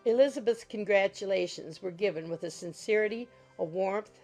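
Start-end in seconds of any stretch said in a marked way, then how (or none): none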